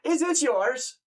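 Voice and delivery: high-pitched, nosy Scottish countryman; Scottish farmer voice pitched well up. Acted. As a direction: call out as a surprised Scottish farmer. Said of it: "like a Scottish person or whatever".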